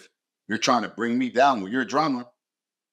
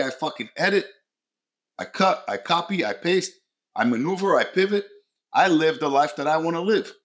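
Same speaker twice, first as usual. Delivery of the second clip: rising excitement; 6 semitones higher than usual. Retold or imitated. Listing how he reshapes life.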